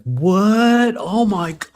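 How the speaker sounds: high, loud startled gasp; shocked. Retold or imitated. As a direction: blurt it out in shock. Mock shocked reaction.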